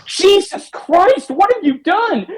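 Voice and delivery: high, slow, hammed-up horror; shocked Indy. Indy reacts to the soldier's confession in slow, high mock shock. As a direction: stretch it out in mock disbelief, way up high. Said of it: "just says".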